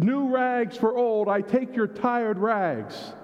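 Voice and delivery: high, slow, drawn-out vendor call; ragman's call. Acted. Ragman calling after comforting the woman, slow high call. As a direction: stretch it out like a street cry. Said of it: "he said".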